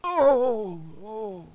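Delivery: acted elderly yelp fading to a whimper; old man whimpering. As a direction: cry out in pain, then whimper. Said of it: "you hear the old man whimpering".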